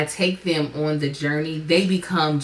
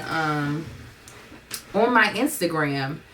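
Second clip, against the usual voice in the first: ordinary talk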